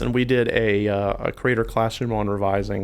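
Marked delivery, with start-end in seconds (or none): none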